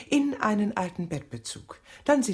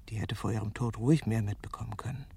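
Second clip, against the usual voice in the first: hushed reflective narration, dipping into whispers; quiet brooding memory voice; inner monologue; 6 semitones lower, 3 dB quieter than usual. Acted. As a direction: speak to yourself in private thought.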